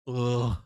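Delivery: loud groan of disgust; one loud fed-up groan; frustrated groan. Real, not acted. Groans at market.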